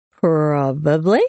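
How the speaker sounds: slow, stretched single word; lazy drunk admission; drunken slur. A narrator's reading. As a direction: draw it out tipsily, unrepentant. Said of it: "the slur in his voice".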